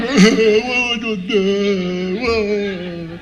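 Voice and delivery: long, high, drawn-out mock wail; hammed-up crying. Man imitating himself crying over the book, hammed-up wailing. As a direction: bawl it very slowly, comically helpless. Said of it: "I'm sitting there crying".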